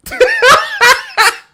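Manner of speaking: high, loud burst of laughter; helpless high cackle; real loud laugh. Real, not acted. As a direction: crack up at your own jibe. Mocking Eddie Hearn's change of accent.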